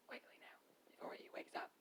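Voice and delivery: very soft, secretive whisper. Acted. Captor hurrying before he wakes, whispering. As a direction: whisper it urgently under your breath. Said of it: "I heard a voice whisper".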